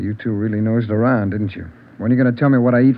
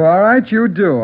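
irritated snap; 8 semitones higher, 6 dB louder than usual. Acted. Suspect snapping at detective.

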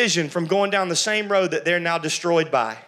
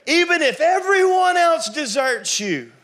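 boastful Peter; 9 semitones higher, 5 dB louder than usual. Acted. Peter's boast voiced in sermon.

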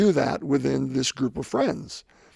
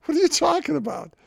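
much higher, incredulous outburst; retold frustration. Podcaster retelling snapping at his buddy's advice, exasperated and high. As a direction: throw it back at him in disbelief. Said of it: "getting really frustrated with him".

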